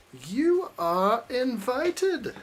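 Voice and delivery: a slow, high, drawn-out eerie invitation; slow, eerie movie voice. Pretend. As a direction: lightly voice the creepy movie character, drawing each word out. Imitating a movie character line.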